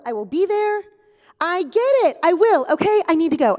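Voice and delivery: loud, fed-up insistence; exasperated. Acted. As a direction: cut the caller off, loudly impatient. Acted annoyed phone call.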